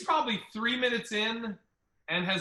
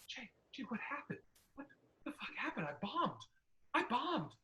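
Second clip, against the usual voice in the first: much softer, breathless whisper, high and shaken; panicked whisper; 4 semitones higher, 13 dB quieter than usual. Retold or imitated. Comic fresh off stage whispering in shock to his friend. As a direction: hiss it out like you've seen a ghost. He looks like he's seen a ghost.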